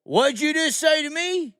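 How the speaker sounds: very high-pitched indignant challenge; imitated screaming cop. Cop challenging the man who heckled him, imitated angry yell. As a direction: imitate an affronted cop yelling back. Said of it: "they're screaming this".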